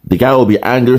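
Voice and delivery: ordinary talk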